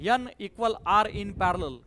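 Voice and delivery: formula said slowly, word by word; slow dictation. Shown as a demonstration. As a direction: dictate N equal R in parallel slowly. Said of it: "काय दिलंय तुम्हाला shortcut मी?". (हळू, शब्द शब्द सांगणे)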